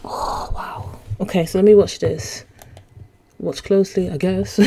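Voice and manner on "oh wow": ordinary talk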